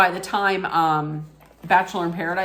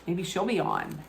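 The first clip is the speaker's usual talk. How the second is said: ordinary talk, nothing marked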